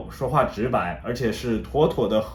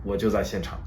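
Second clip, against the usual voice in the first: imitating a crestfallen fan, lower and softer; gloomy retelling; 4 semitones lower, 6 dB quieter than usual. Dutch neighbour recalls the final Ajax lost, suddenly gloomy. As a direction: sink into a low, quiet, mock-dejected voice. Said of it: "这个时候他的脸色突然暗淡了下来".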